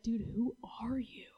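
hushed, stunned question; whispered disbelief. Retold or imitated. Friend stunned by someone's joy, whispered. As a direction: ask it under your breath.

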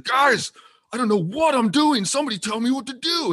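very high, loud mock Scottish plea; horrible Scottish accent. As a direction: holler helplessly as a hammy Scotsman. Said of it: "horrible Scottish accent".

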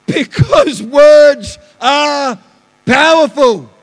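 high, very loud, drawn-out proclamation; preacher's shout. Real, not acted. Preacher driving home his point to the church, shouting slowly. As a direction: stretch each word out at full volume.

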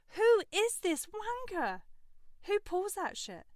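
outraged rhetorical question; indignant outburst. Real, not acted. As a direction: fling it out in outrage.

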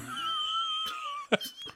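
high, strangled shriek of laughter. Real, not acted. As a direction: laugh till it squeaks.

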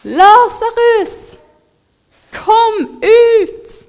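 loud, high-pitched call with long drawn-out words; grito a viva voz. A narrator's reading. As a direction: call a man out of his tomb at full voice. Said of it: "ropte han ut med høy".

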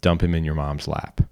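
plain reading voice

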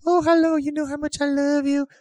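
very high mock-female falsetto; flirting wife. Wife inviting lover over, hammed-up falsetto coo. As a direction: sing out sweetly as a cartoon wife.

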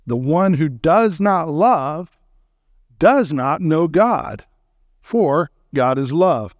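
slow, deliberate verse reading; solemn, unhurried reading of the verse; weighty scripture reading. Acted. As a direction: let each phrase land slowly. Reads verse slowly for weight.

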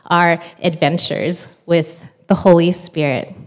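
normal talking voice

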